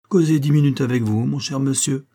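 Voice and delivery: plain reading voice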